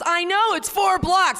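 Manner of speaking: hammed-up sulking newcomer; whiny newcomer. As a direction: squeak it like a sulky newcomer. Newcomer whines back.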